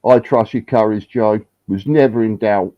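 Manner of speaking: ordinary talk, nothing marked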